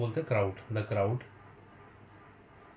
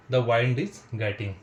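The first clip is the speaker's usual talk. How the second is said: words drawn out one by one; slow dictation; 6 dB louder, much slower than usual. Shown as a demonstration. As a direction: say it slowly so they can write. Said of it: "teacher dictating slowly". (धीरे-धीरे लिखवाना)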